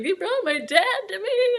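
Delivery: high, drawn-out, tearful retelling; imitated sobbing. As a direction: re-enact a slow, high-pitched sob.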